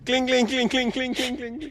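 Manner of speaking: high singsong cling cling; bell jingle imitation. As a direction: ring out like a little bell.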